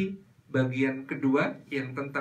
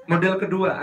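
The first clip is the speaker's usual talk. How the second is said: ordinary talk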